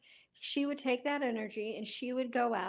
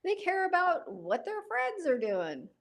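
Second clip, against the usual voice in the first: high, loud retold scolding; mock exasperated lecture; 8 semitones higher, 5 dB louder than usual. Telling sister to stop worrying, mock exasperated. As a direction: scold her, jumping higher on the last word.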